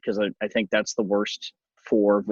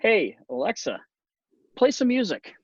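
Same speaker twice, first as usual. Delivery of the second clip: put-on higher, kid asking Alexa for music; a hint of a child calling to Alexa; lightly voiced kid; 10 semitones higher than usual. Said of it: "my kids say".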